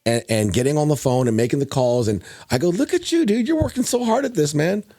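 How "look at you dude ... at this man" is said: higher, lively mock-proud praise with a nudge; a dad hyping his kid up, then ribbing him about school; proud teasing dad. Dad to his son, half-teasing, proudly higher praise.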